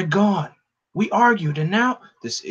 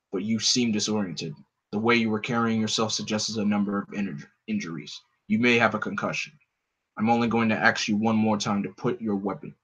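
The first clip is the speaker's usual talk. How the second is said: a cold, commanding vigilante; Batman; 3 semitones lower than usual. Acted. Batman voiced in the story.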